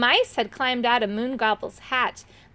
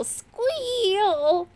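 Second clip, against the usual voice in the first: high, drawn-out squeal; slow, high-pitched storybook squeal; squealing word; 9 semitones higher than usual. A narrator's reading. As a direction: stretch the word like scraping metal. Narrator mimics the sword's squeal.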